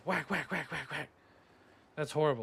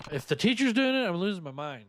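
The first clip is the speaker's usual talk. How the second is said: much higher and louder outburst; outraged; 5 semitones higher, 6 dB louder than usual. Real, not acted. Streamer outraged at the teacher, loud high outburst. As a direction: blurt it out in disbelief.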